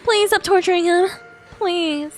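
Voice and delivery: usual speaking voice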